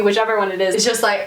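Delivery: normal talking voice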